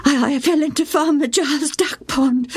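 put-on high, hesitant boy's voice; stammering child; shamed boy. A narrator's reading. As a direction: own up sheepishly. Joe confesses to mother.

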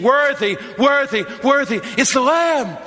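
exultant high shout of worship; shouted praise. Acted. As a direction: cry out Worthy like a hymn of praise. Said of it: "we sing".

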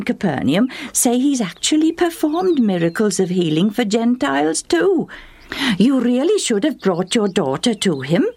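high-pitched, animated acquaintance; chatty neighbour woman. A narrator's reading. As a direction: gossip eagerly in a high storybook voice. Same woman goes on urging.

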